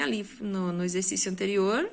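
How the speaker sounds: ordinary talk, nothing marked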